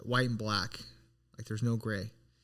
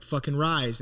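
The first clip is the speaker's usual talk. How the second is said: ordinary talk, nothing marked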